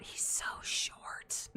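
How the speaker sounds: breathy whispered swoon; mock whisper. Cohost mimicking a girl gushing, whispered. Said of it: "heard a chick say".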